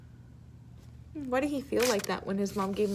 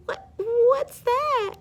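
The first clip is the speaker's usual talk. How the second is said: much higher, stammering small voice; curious tearful child; 14 semitones higher, 5 dB louder, much slower than usual. Acted. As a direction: sniffly kid perking up.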